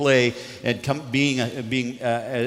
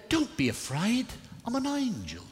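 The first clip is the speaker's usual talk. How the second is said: high, hushed British angel; British accent; 12 semitones higher than usual. Acted. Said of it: "In his best British accent".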